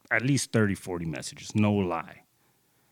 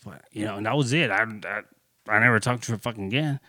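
normal talking voice

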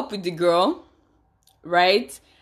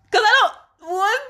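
much higher and louder, shocked; shocked disbelief; 13 semitones higher, 9 dB louder than usual. Real, not acted. Host reacts to the ex-girlfriend story with shocked disbelief. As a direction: gasp out your disbelief.

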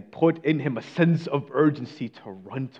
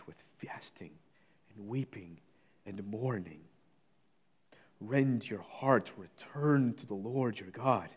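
hushed reading; 3 semitones lower, 10 dB quieter than usual. Shown as a demonstration. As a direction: read it as a quiet, intimate plea. Rereading the same passage hushed.

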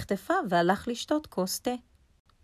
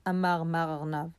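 ordinary delivery, nothing marked

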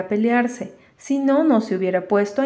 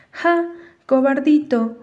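higher, slow taunting call; mild jeering shout; 5 semitones higher, 3 dB louder than usual. A narrator's reading. Dan shouts back at Walter, half-voiced jeering yell. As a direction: jeer it out without a full shout. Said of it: "volvió a gritar".

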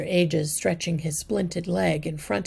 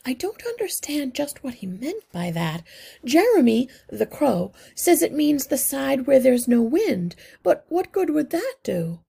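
higher, puzzled and anxious; a fretful mother asking for help; worried mother mouse; 8 semitones higher, 4 dB louder than usual. A narrator's reading. Mrs Frisbee voiced puzzled.

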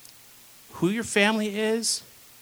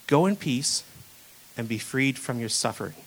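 tender blessing; 5 semitones lower, 3 dB quieter than usual. Retold or imitated. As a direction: speak it gently like a blessing. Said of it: "He said to her".

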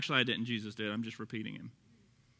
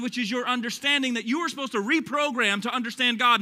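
ordinary talk, nothing marked

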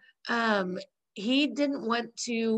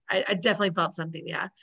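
quick quote; 3 semitones lower, much faster than usual. Retold or imitated. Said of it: "And Rachel's like".

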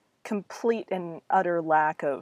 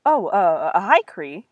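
nervously; 8 dB louder, slower than usual. A narrator's reading. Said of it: "he said nervously".

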